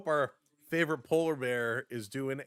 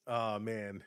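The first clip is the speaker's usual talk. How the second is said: ordinary talk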